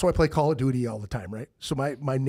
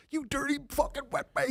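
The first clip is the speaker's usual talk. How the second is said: high-pitched mock meltdown; hammed-up raging insult; losing his mind; 12 semitones higher, 3 dB quieter than usual. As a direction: imitate a gamer cursing, way up high. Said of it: "He just started losing his mind".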